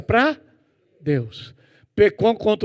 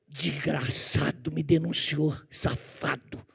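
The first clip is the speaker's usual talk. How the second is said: slow, softer, bitter cursing under the breath; muttered anger; 3 semitones lower, 6 dB quieter, slower than usual. Retold or imitated. Boy cursing the colleague who reported him, muttered bitterly. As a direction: spit the insults low and resentful.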